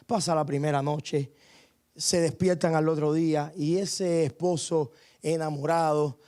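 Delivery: normal talking voice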